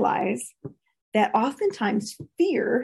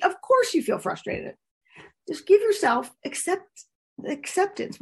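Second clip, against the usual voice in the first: Of course you feel frustrated, pitched up; higher, validating retake; 8 semitones higher than usual. Shown as a demonstration. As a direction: lift the voice to validate. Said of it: "say to yourself".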